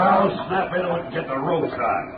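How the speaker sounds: loud order. Acted. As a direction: hurry the men along. Commissioner urges the diggers.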